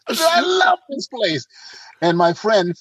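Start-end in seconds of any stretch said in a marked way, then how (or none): 0.1-1.5 high, drawn-out gush; hammed-up rapture; delighted exclamation. Acts out his delight with Rome.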